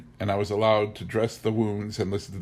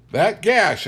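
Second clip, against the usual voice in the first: usual speaking voice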